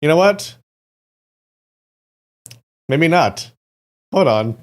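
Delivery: high, loud excited exclamation; excited outburst. Real, not acted. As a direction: burst out at the screen. Sudden outburst at the screen.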